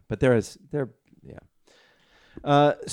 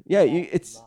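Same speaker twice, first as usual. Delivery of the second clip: ordinary delivery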